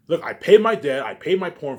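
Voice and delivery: speaking to the fans, loud and insistent; loud insistence. Real, not acted.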